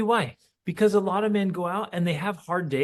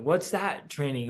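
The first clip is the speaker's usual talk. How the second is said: ordinary delivery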